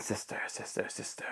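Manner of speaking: whispered, fading echo; cave echo. A narrator's reading. Echo answering the duck from the cave, whispered. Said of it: "the voice from the cave came back".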